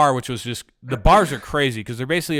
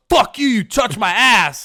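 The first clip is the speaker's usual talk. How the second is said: louder, higher, slow furious accusing; angry accusation; 8 semitones higher, 5 dB louder than usual. Retold or imitated. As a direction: point and bark the accusation.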